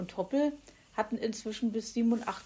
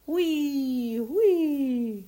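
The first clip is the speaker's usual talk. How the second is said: high, loud spooky hui hui; one last teasing ghost wail; mock ghost sound; playful ghost hoot; 7 semitones higher, 10 dB louder than usual. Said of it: "lenkte Zwitschi ein".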